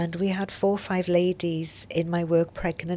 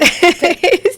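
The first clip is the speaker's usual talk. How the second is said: sudden high loud laugh; loud burst of laughter; 12 semitones higher, 12 dB louder than usual. Real, not acted. Laughs at hormone excuse.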